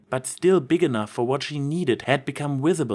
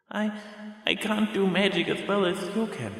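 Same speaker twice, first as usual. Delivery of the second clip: put-on much higher, hesitant child's confession; a child telling her dying mom; timid little filly; 9 semitones higher, 3 dB quieter than usual. Child doubts herself.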